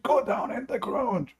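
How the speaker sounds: high mock yell of a drill sergeant, slow; imitating a screaming drill sergeant. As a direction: bark the order like an angry sergeant. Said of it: "he scream at me".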